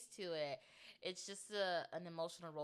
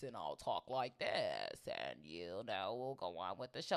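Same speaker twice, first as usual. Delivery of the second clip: disguised ransom voice; 10 semitones lower than usual. Shown as a demonstration. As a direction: drop it low and drag it out like a masked kidnapper. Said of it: "like a ransom, like a rodeo box".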